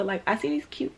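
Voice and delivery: ordinary delivery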